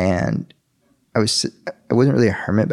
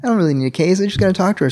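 high, excited praise; thankful excitement; 10 semitones higher, faster than usual. Retold or imitated. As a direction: thank the Lord in giddy relief. Sees the girl at last.

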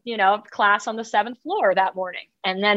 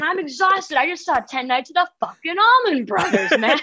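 exasperated snap; 5 semitones higher than usual. Retold or imitated. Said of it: "Kim was like".

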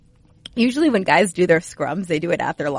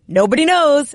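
higher, louder, playful shrugging answer; giggly shrug; 9 semitones higher, 5 dB louder than usual. Retold or imitated. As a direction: toss it off teasingly, half-giggling. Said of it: "starts giggling, shrugs, and goes".

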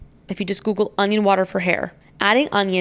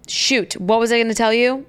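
ordinary delivery

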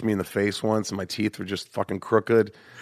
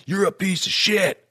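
high, drawn-out mock yell; imitated high-pitched furious jeer; angry insult; 12 semitones higher, 3 dB louder, slower than usual. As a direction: hurl it slowly at a rival. Retold enemy's insult before fight.

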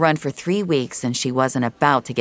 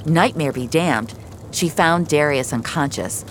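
ordinary delivery, nothing marked

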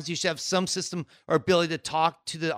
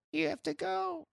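mock timid usher; 11 semitones higher, 12 dB quieter than usual. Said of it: "you hear this low voice".

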